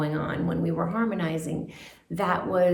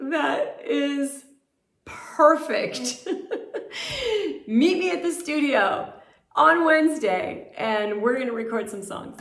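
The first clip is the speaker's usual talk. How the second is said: higher, louder, through laughter; Richard Perry; 8 semitones higher, 4 dB louder than usual. Retold or imitated. Producer delighted by the audition, laughing retold exclamation. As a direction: laugh your way through his delighted verdict. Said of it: "he just burst out laughing".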